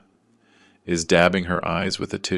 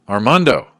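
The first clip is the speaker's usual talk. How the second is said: loud, higher cry of recognition; exclaimed greeting; 5 semitones higher, 8 dB louder than usual. A narrator's reading. Narrator greeting his oldest friend, loud exclamation. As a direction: exclaim the name, voice leaping up.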